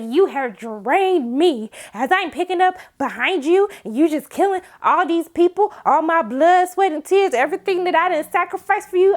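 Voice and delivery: hint of a wounded mother; dramatic Constance. Constance scolding her grandson, lightly voiced dramatic. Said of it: "the way that she did that whole speech".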